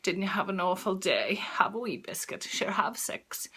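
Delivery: soft, coaxing little voice, sinking to a whisper; whiny inner voice. Acted. The voice in your head tempting a dieter, whiny and hushed. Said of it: "the whiny voice that".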